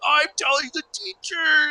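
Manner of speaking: put-on high, taunting kid voice; sing-song tattle threat; tattletale child. Kid threatening classmate who broke the door, high taunting.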